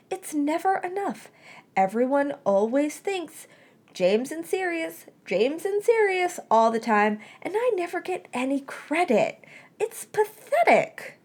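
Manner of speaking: high, whiny, hammed-up complaint; mock self-pity. A narrator's reading. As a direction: wail theatrically about being overlooked. Remus melodramatic self-pity.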